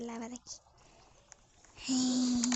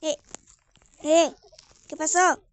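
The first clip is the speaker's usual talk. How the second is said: calling out; 12 dB louder than usual. Real, not acted. Mom calls from another room.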